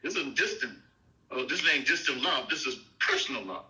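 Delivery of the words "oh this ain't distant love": normal talking voice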